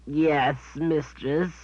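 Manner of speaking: high, whining beast voice; a guilty creature whine. Acted. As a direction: whine like a caught pet. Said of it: "Samuel whined".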